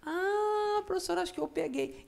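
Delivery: high, bright student voice; teacher plays the student, pitched high; mock student. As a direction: chirp it like a pupil who just got it.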